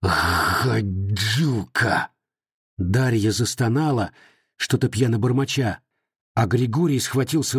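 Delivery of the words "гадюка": low, very slow hoarse rasp; hoarse growl. Acted. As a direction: rasp the word with loathing. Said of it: "прохрипел". (хриплое, медленное рычание)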